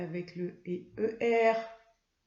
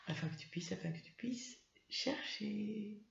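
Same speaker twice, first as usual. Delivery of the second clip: quiet trailing off; 13 dB quieter than usual. Shown as a demonstration. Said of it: "Je vais donc me promener".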